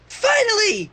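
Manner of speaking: high squealing Pinkie voice; excited high squeal. A narrator's reading. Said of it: "she squealed".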